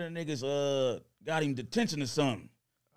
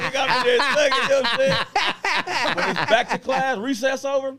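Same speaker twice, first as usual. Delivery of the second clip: louder, higher, laughing shout; excited shouting; 9 semitones higher, 8 dB louder than usual. Real, not acted. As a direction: hype it up, laughing. Hyped retelling, laughing, shouting.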